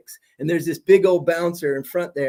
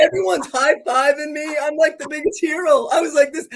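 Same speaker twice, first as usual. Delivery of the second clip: excited; 9 semitones higher than usual. Real, not acted. Proud climax of concert story.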